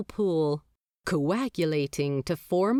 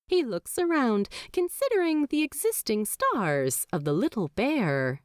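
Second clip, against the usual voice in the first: usual speaking voice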